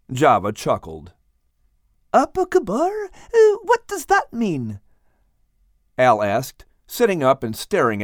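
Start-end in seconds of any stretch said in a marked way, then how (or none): 2.1-2.9 high-pitched puzzled echo; high little fellow trying out unfamiliar words. Acted. Al repeats the unfamiliar Malay phrase. 3.1-4.8 very high, slow question; high-pitched little fellow, curious; puzzled Al. Acted. Al asks about Malay phrase.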